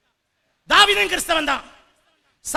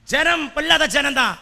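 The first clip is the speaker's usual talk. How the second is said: ordinary talk, nothing marked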